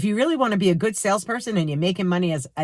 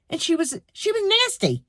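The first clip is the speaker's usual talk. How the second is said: higher, indignant cry of nasty; outraged; 9 semitones higher than usual. Real, not acted. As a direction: spit out she was nasty high up. Outraged about the cashier.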